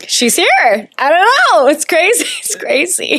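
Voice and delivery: high, giddy disbelief breaking into a laugh; excited disbelief. Real, not acted. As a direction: squeal it out, still stunned. Overwhelmed recalling the crown.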